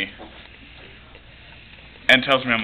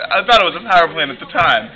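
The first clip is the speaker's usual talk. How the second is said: usual speaking voice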